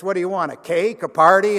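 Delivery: loud sneering questions; mocking taunt. Friend mocks Agnes at counter.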